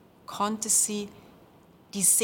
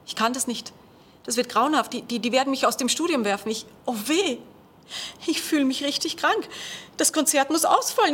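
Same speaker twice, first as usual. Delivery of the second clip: rising panic, higher and louder; panicked; 5 semitones higher, 4 dB louder, faster than usual. Acted. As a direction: voice climbing in dread. Panicking stage fright quote.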